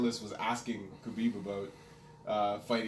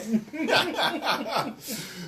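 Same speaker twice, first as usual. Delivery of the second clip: high laugh; 10 semitones higher than usual. Real, not acted. Laughing after the story.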